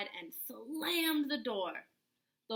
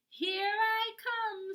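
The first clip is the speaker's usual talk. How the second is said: much higher, slow, sing-song; wolf crowing as he slides; gleeful wolf; 10 semitones higher, 4 dB louder, slower than usual. Acted. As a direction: gloat on the way down. Wolf gloats down chimney.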